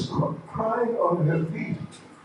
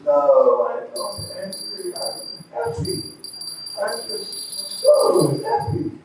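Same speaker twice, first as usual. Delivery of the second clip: Rukmini. Acted. Rukmini voiced, happy protest.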